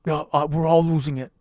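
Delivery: put-on old senator voice; Bernie Sanders. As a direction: grumble along as the old politician. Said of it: "impression of Bernie Sanders".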